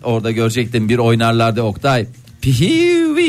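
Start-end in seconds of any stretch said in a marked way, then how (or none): 2.4-3.3 shrill whistle-like wedding whoop; high-pitched festive whoop. Retold or imitated. Radio host imagining the dancing at the groom's welcome, high whoop. Mimics cheer at wedding dance.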